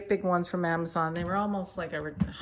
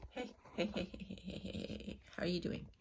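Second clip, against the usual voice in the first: soft whisper; 7 dB quieter, faster than usual. Real, not acted. Soft cooing at cat.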